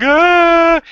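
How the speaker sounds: loud, high mock wail; imitated child's crying. Parent showing how the kids cried, mock wailing. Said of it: "when they were crying".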